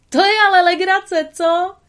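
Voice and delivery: high, loud, gleeful yell; gleeful shout. A narrator's reading. Tramp chasing chickens, shouting gleefully. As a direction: whoop it out with joy. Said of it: "pokřikoval rozjařený Tramp".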